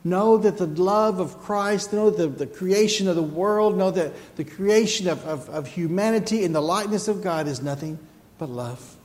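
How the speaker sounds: fervent pulpit cry; preaching at full force. Real, not acted. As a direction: drive it home to the congregation. Preaching climax.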